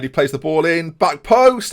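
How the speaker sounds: rising to a loud peak; urgent commentary. Real, not acted. Calling a dangerous cross live.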